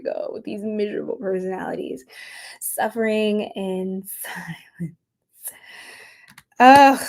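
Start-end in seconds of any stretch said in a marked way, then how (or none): 2.6-5.0 whisper. Shown as a demonstration. Rereading the card, amused.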